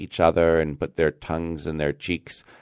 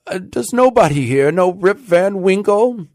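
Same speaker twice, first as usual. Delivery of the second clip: high, loud plea to the crowd; despairing cry; 14 semitones higher, 6 dB louder than usual. A narrator's reading. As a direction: call out desperately for anyone who knows. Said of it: "cried out in despair".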